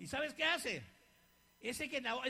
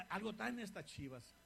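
suspicious aside; 4 semitones lower, 7 dB quieter than usual. Retold or imitated. Repeated pastor's thought.

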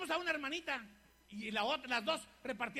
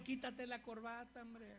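devil tempting; 9 dB quieter, slower than usual. Acted.

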